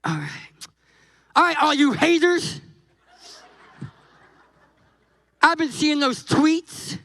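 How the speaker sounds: mildly cocky swagger with a laugh; cocky Moses. Retold or imitated. Moses mildly cocky, laughing at Miriam and Aaron. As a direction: strut a little, teasing the haters. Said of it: "he got a little cocky".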